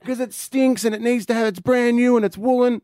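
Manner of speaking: put-on high-pitched teenage comeback; imitated indignant daughter. Daughter tells dad why it needed washing, imitated indignation. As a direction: fire back in a high, aggrieved voice. Daughter argues back in retold row.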